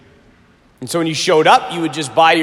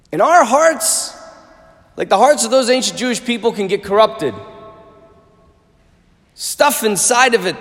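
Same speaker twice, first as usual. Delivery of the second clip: raised, driving sermon voice, higher pitch; preaching at full force; 5 semitones higher than usual. Acted. Priest urging students that God wants their heart, fervent preaching. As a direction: build the sermon to a passionate peak.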